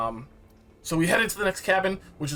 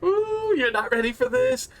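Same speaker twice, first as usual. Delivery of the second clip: teasing; 14 semitones higher than usual. Retold or imitated. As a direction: tease a friend who has no idea what's coming. Friends teasing about spoilers.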